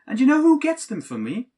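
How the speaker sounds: exasperated outburst. Acted. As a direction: snap back in disbelief. Exasperated retort about presents.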